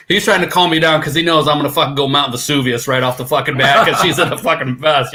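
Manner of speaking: ordinary talk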